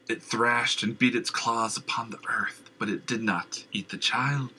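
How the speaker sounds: hushed fearful whisper. Acted. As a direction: whisper the horror. Madman whispers memory.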